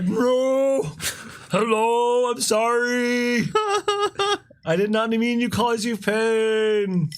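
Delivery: apologetic whale voice. Acted. Sperm whale apologizing, acted.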